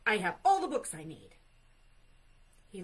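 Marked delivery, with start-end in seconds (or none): 0.1-1.4 loud, quick bear interruption; cutting him off. A narrator's reading. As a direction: cut in firmly at full voice. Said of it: "cut him off".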